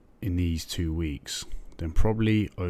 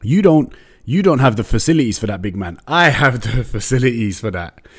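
brash, higher, louder street swagger; cocky challenge; 5 semitones higher, 6 dB louder than usual. Retold or imitated. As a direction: square up to a doubter. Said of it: "And I was like".

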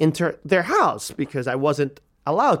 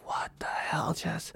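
whispered disbelief; hushed, appalled aside; aghast whisper; 3 semitones higher, 12 dB quieter, slower than usual. Retold or imitated. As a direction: whisper it in acted shock. Aghast private reaction in story.

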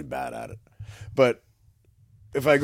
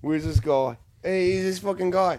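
mock Australian accent; 8 semitones higher than usual. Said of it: "my shitty Australian impressions".

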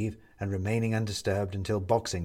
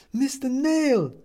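high, slow call through a door; muffled call; 6 dB louder, slower than usual. A narrator's reading. Neighbour calling through the door, muffled and high. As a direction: call his name from the hallway, muffled. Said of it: "says a woman's voice from the hallway, muffled".

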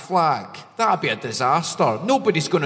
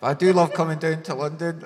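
ordinary talk

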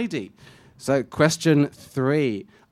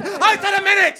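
angry shout; 14 semitones higher than usual. Acted. Actor snaps back.

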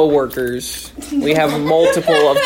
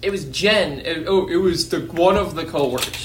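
ordinary talk

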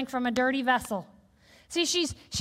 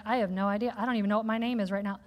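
dazed rush; 5 semitones lower, 3 dB quieter, faster than usual. Retold or imitated. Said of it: "I was like".

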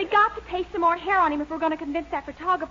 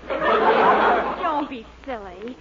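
slow, lower, through laughter; laughing; 6 semitones lower, much slower than usual. Acted. Clara waves off the mustache idea, slow laughing reply.

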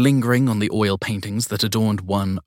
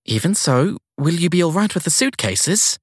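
put-on flustered young woman; 6 semitones higher, 3 dB louder than usual. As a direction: caught off guard and eager to please. Sadie talks to chauffeur.

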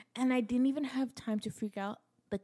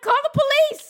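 very high, loud shriek; mock frantic scream; 14 dB louder than usual. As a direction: yell it as if dying of pain. Screaming order in labor.

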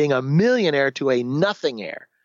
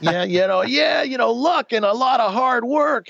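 cocky high-pitched humblebrag; smug reply; 9 semitones higher than usual. Retold or imitated. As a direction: play the arrogant lucky guy brushing it off. His smug younger self answering.

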